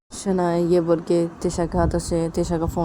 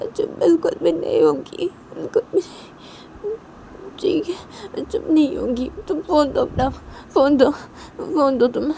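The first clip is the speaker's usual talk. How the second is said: high, whiny, tearful-sounding defiance; hurt defiant girl; 7 semitones higher than usual. Retold or imitated. Shanaya defying Tisha after the slap, high hurt whine. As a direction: cry out after being slapped, still refusing. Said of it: "Shanaya Tisha को बोलती कि".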